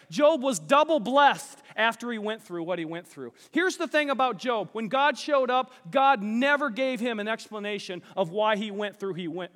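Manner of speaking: ordinary talk, nothing marked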